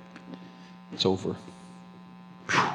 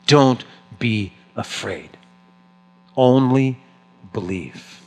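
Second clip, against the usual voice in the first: reassuring; slower than usual. Acted. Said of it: "Look at it with me".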